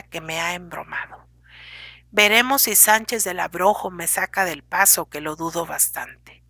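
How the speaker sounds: ordinary delivery, nothing marked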